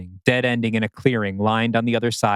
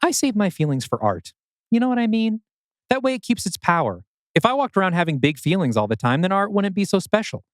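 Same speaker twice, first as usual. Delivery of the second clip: lightly voiced higher older man; higher Arthur voice; 8 semitones higher than usual. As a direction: speak up high, dry and detached. Arthur's quoted lines.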